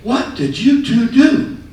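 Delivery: high, loud, slow indignant lady voice; scolding lady. Retold or imitated. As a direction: demand it like a shocked respectable lady.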